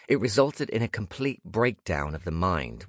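ordinary delivery, nothing marked

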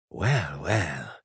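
breathy, falsely cheerful host; happy, breathy voice. Acted. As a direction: purr it with fake delight. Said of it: "he said in a happy, breathy voice".

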